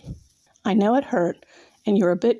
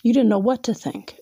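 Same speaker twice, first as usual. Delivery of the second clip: ordinary delivery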